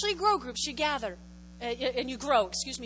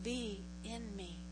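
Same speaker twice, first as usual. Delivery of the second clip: soft model prayer; 4 semitones lower, 9 dB quieter, much slower than usual. Shown as a demonstration. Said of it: "say, say".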